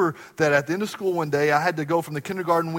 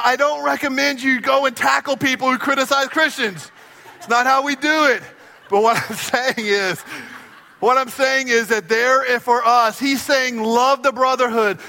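raised, ringing preacher's voice; full-force preaching; 8 semitones higher, 7 dB louder than usual. Acted. Pastor preaching to the congregation, raised and ringing. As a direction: preach it big to the room.

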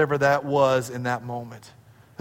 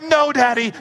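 put-on pleading child; 8 semitones higher, 4 dB louder than usual. Daughter pleading before spanking.

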